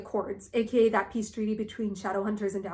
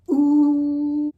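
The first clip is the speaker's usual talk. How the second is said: delighted ooh; 4 semitones higher, 7 dB louder than usual. Real, not acted. Reacting to Tessa's cold retort.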